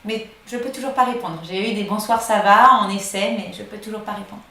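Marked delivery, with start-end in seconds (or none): none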